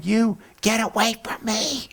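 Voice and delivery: high, slow mock screech of a wife in labour; mock scream. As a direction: imitate the wife shrieking. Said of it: "the wife is screaming at 'em".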